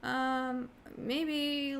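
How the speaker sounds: mock timid tourist dithering to a driver; timid hesitant voice. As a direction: play it small and unsure, backing down. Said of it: "you can't talk to them like that".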